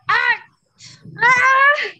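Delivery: loud high roaring cry as it bites; crocodile roar. Acted. Crocodile biting the elephant child, loud high cry. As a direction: snap down with a big hungry cry.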